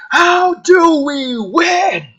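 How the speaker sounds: high, slow, desperate scream at the device. Acted. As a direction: scream it at the machine, drawn out. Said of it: "screams at the translation device".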